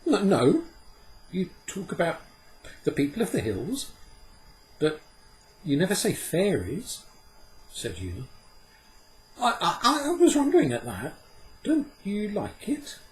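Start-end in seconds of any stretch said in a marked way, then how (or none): 0.0-7.0 put-on high girl's voice, eager; a girl quick to explain; girl answering. Una answers Puck, high eager girl's voice. 9.4-13.0 put-on high, fast, flustered girl with a laugh; stammering girl. Una asks Puck, high flustered girl's voice.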